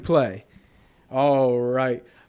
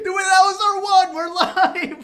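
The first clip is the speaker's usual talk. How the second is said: high, laughing outcry; excited shout; 13 semitones higher, 4 dB louder than usual. Real, not acted. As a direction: yell in shocked dismay. Show start ruined by swearing.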